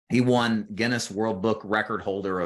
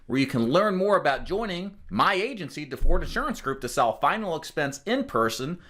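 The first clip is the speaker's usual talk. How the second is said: ad read; 8 semitones higher than usual. Acted. Agency promo reminder.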